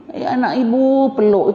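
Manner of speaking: hammed-up doting mum calling her son to hug; mock mother. Mother in holiday drama voiced.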